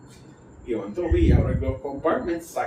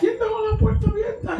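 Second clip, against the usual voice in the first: mock accusing. Quoting the woman accusingly.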